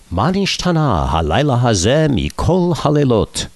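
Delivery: ceremonial Hebrew recitation. Acted. Friend addresses the Queen at knighting, ceremonial Hebrew recitation.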